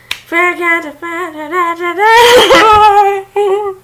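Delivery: loud, high villain laugh; evil cackle. Acted. Villain gloating to boss after the deed, loud high cackle. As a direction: cackle in triumph like a cartoon villain. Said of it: "man, that's cringy".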